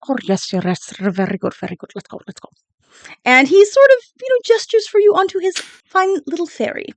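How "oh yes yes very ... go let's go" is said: fading captain mutter. Acted. Captain fades to whisper.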